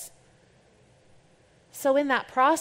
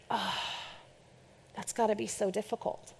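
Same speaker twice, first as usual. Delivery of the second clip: quiet sympathy; 11 dB quieter than usual. Retold or imitated. Sympathy for a sick leader.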